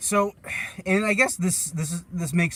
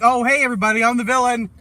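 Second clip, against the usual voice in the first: loud, high, bubbly villain; mock a perky villain announcing herself; cheery villain; 8 semitones higher, 8 dB louder than usual. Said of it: "she's just like".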